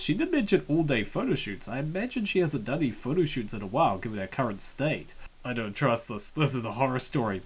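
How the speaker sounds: ordinary talk, nothing marked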